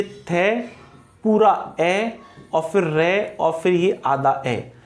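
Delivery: थे, पूरा ए, रे, आधा ए in slow separate pieces; syllable-by-syllable breakdown. Shown as a demonstration. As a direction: spell out थेरे sound by sound, pausing between parts. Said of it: "आप ऐसे समझ लीजिए".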